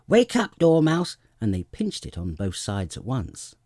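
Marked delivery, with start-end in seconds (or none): 0.1-1.2 high, loud wake-up call; shouting to wake him. A narrator's reading. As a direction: yell at the sleeping Dormouse. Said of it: "they both cried".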